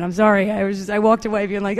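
usual speaking voice

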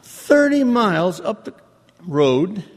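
THIRTY pitched high and hammered in disbelief; exasperated stress. Retold or imitated. Telling the snob coach where Oceanside is, with THIRTY shouted high. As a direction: throw THIRTY up high, outraged. Indignant retort to snub.

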